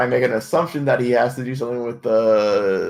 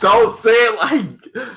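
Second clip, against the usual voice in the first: laughing; 10 semitones higher, 6 dB louder, much slower than usual. Real, not acted. Protesting while laughing.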